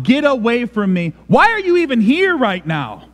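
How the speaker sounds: high, frantic pushing away; stiff-armed repulsion. Acted. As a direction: plead and protest, driving them away in a rising pitch. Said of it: "This is a stiff-armed out".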